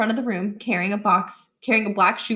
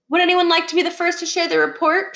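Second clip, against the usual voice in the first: bright teacher voice; 9 semitones higher, faster than usual. Acted. Teacher asking class brightly.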